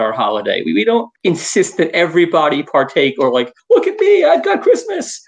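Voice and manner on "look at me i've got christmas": high-pitched mocking brag; mock showing off. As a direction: squeal it like an attention-seeking show-off. Mocks showy holiday celebrators.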